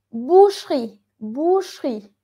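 "Boucherie" said "boosh-ree", E dropped; silent middle E. Shown as a demonstration. Teacher models a difficult word, middle E nearly silent. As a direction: say "Boucherie" in two beats with no middle E. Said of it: "esta E suena muy bajito".

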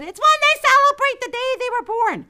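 put-on high, loud impatient child; a schoolgirl explaining to her mom; high impatient child's voice.

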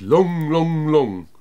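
fading repeated echo. A narrator's reading. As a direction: let each long trail off like an echo. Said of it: "sounded more like an echo".

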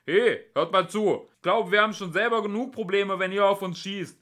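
high, loud exasperated shout; full-voiced, reasoning yell; bellowing. A narrator's reading. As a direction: bellow it across at armed opponents. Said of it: "Ford brüllte los".

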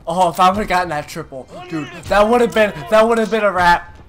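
loud, high excited rant; excited gamer. Real, not acted. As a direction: yell it, pumped up. Near triple kill.

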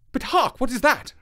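high, urgent hushing question; keyed-up, high-pitched alert; startled alarm. Acted. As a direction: break off suddenly on hearing something. Holmes startled by a new sound.

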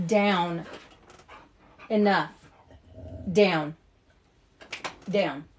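firm loud commands to the dog; mom voice. Real, not acted. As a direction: bark out short stern orders. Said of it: "Had to get my mom voice on".

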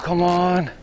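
usual speaking voice

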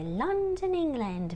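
playful, high-pitched put-on London accent; hammed-up London accent for a class; fun London accent. Shown as a demonstration. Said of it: "with a little fun accent".

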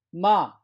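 마 starting high, falling sharply, loud; fourth tone. Shown as a demonstration. Teacher models tone four for students, loud falling 마. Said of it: "사 성은".

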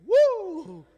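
high, loud joyful whoop; happy whoop. Acted. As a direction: let out a gleeful "woo". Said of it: "So he's so happy".

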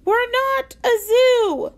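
不耐烦; high, slow, irritated snap; testy. A narrator's reading. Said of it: "said testily".

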